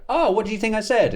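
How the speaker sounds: a light bright jab at the heckler; mock playful question. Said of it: "And I'd go".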